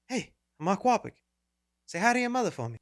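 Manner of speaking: quiet, put-on tough-guy actor voice; Mark Wahlberg. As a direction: do a light, joking actor impression. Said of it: "his regular Mark Wahlberg voice".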